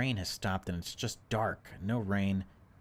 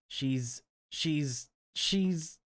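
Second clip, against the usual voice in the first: father stammers, rising higher on each she's; stammering father; 7 semitones higher, much slower than usual. Acted. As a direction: get stuck on the word, each try higher. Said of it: "he can't get it out".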